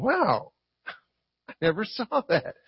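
soft, amazed "wow" dropping to a near hush; quietly astonished, as if just discovering it; hushed wonder. Retold or imitated. Reader of scripture to himself, hushed amazement.

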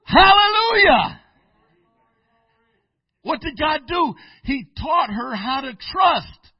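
loud, high, slow preacher's cry; preaching at full force. Acted. Preacher celebrating answered prayer, loud and slow. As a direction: ring it out to the rafters.

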